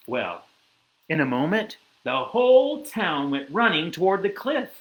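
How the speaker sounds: usual speaking voice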